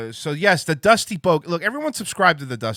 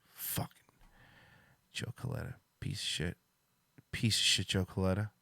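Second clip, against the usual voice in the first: whispered curse; 5 semitones lower, 11 dB quieter than usual. Acted. Cursing a friend who ignored call.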